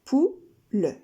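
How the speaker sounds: Pou-le said as two beats, pou then le; syllables split apart. Shown as a demonstration. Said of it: "Poule a deux syllabes à l'écrit".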